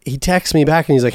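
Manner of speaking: ordinary talk, nothing marked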